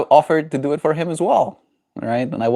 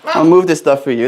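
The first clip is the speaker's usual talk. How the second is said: ordinary talk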